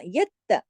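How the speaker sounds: यत्त with the त pressed twice; firm double T. Shown as a demonstration. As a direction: hit त hard in यत्त, nothing loose. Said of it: "ता को सख्ती से पढ़ना है". (यत्त, ता सख्ती से)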